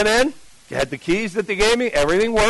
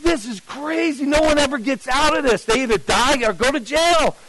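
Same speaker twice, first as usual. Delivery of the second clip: alarmed realization; 8 semitones higher than usual. Retold or imitated. Panic realizing the danger.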